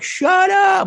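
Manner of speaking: high, loud squeal of disbelief; excited high-pitched yell; disbelieving shout. Retold or imitated. Replays own disbelieving excited reaction.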